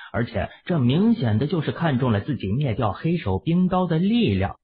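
ordinary delivery, nothing marked

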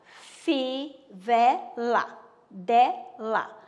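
Fivela stretched very slowly, dela loud; teacher showing the class that fivela rhymes with dela; rhyme demo. Shown as a demonstration. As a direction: hold Fivela long, then land dela. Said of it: "ó, ela, né?".

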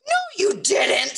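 put-on high-pitched, indignant child's denial; snapped retort. A narrator's reading. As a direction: snap back like an offended little kid. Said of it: "snapped Sam".

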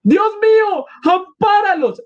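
very high pleading cry; acted high-pitched prayer of alarm; woman's exclamation. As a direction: cry out high to God for protection. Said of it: "exclamó una mujer persignándose".

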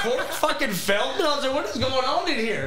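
high-pitched, amused disbelief; incredulous retelling on the edge of laughter; retold amazement. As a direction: replay your astonishment, pitch way up. Retells his amazement at seeing Corey.

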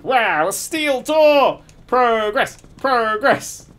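loud, high, drawn-out cheering; exaggerated triumph. Player celebrating a first steel door, hammed-up loud triumph. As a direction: crow it like a grand achievement.

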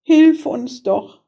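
put-on pleading girl's voice, pitched high; desperate cry. A narrator's reading. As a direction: cry out as a despairing little girl. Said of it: "rief sie aus".

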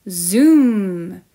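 loud, drawn-out zoom; zooming sound effect. A narrator's reading. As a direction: let the word whoosh past at full volume. Narrated whoosh of a fast flyer.

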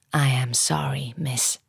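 low, gentle gentleman's apology; genuinely sympathetic. A narrator's reading. Gentleman consoling a desperate woman, sympathetic. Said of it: "sounding genuinely sympathetic".

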